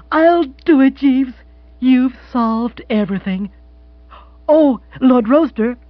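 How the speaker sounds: high, loud, gushing lady; excited, lifted character voice; delighted wealthy widow. Acted. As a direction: exclaim with grand delight in a woman's high voice. Voices Mrs Spottsworth in dialogue.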